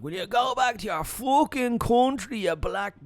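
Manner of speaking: high, loud, drawn-out mock yell. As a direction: yell it like an abusive stranger from a car. Retold racist abuse from car.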